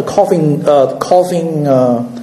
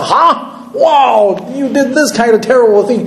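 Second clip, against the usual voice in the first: retold shock, higher-pitched exclamation; 6 semitones higher than usual. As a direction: react aghast, pitched up. His own shocked reply to story.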